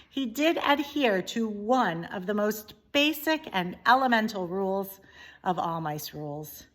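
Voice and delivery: usual speaking voice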